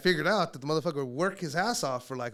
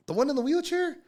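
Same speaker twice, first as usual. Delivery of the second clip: doubtful; 12 semitones higher, 5 dB louder than usual. Acted. Balking at meeting the wheelchair guy.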